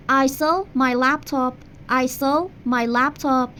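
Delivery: slow teacher demo of the sentence; slow model sentence. Shown as a demonstration. Teacher modelling English for Persian students, slow. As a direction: say it slowly for repetition. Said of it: "یا".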